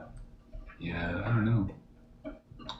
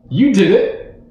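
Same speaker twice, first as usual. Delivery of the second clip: excited; 10 semitones higher, 10 dB louder than usual. Real, not acted. Teasing friend over three-way joke.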